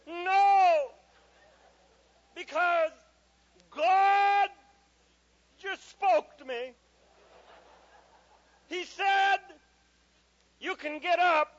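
high, very loud halting declaration; hammed-up booming kid voice; pompous child. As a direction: announce it phrase by phrase like a tiny prophet. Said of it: "in a very pompous tone".

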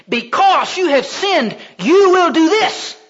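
slow, high, loud divine sentence; thundering judgement. Acted. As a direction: pronounce doom slowly, building to a shout. God pronounces judgement, acted.

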